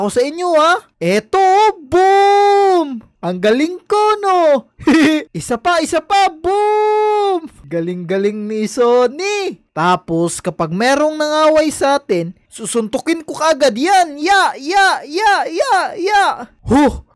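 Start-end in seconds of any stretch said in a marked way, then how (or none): 1.9-3.0 hoch und laut ausgerufenes boom; mock tumble boom. Shows off a tumbling move. 6.3-7.5 hoch und laut ausgerufenes boom; mock tumble boom. Second tumble on request. 14.2-16.2 mock punching cries. Shows punching the bully.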